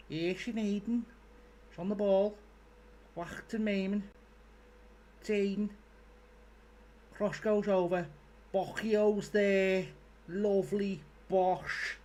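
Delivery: hammed-up Scouse commentator; overdone Scouse commentary. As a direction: call the play like a Liverpool fan, overscoused. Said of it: "I've overscoused that now".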